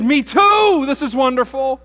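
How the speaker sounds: high, loud gushing delight; thrilled to find a fellow believer; delighted rescuer. Acted. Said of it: "the man said".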